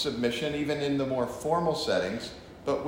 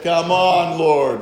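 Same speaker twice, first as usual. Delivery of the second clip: exasperated plea; 3 semitones higher, 10 dB louder, slower than usual. Acted.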